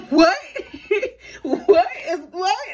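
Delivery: high, loud "what" through laughter; cracking up in disbelief, voice shooting up; laughing disbelief. Real, not acted. Storyteller to viewers about going to jail, laughing incredulity.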